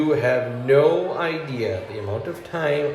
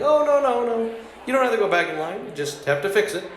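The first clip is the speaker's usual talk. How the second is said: much higher, eager clerk voice, too nice; 9 semitones higher than usual. Retold or imitated. Yo tells the customer he need not requeue, eagerly high. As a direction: play the overly helpful clerk.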